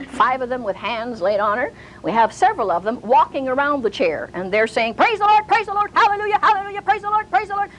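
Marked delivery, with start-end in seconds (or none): 4.9-7.7 mock fervent praise. Church members praising around the chair, hammed-up high chant. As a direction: rattle off praises in a high, fervent sing-song.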